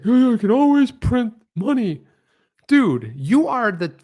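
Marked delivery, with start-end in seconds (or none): 0.0-2.0 high, loud, breezy mock of the Fed chair; a high, carefree sendup of a central banker's boast; mocking Greenspan. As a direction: lampoon him as if printing money were nothing. Mocking Greenspan's printing-money line.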